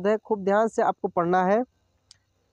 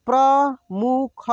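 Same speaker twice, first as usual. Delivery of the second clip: प्रमुख drawn out slowly, syllable by syllable; slow sounding out; much slower than usual. Shown as a demonstration. Teacher slowly sounds out प्रमुख for students. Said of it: "एक एक मात्रा को अलग अलग करके".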